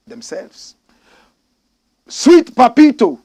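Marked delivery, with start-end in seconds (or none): 2.1-3.2 loud, high, slow call of a name. Acted. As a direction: hail the commenter. Calling out commenter.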